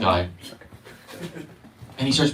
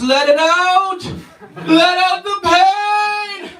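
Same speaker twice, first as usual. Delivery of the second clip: much higher, slow, loud urging; hammed-up guru; 5 dB louder, much slower than usual. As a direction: wail it like a frenzied guru. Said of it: "And he goes".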